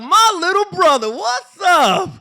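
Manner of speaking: very high, loud excited greeting; gushing sister. Retold or imitated. Sister welcoming her estranged brother, gushing. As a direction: squeal a big, exaggerated welcome. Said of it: "My sister was like".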